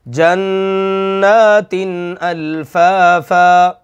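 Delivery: very slow chant of जन्नातिन अल्फाफा; teacher recites the example; slow recitation. Shown as a demonstration. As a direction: draw out the verse melodically. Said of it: "पढ़ेंगे".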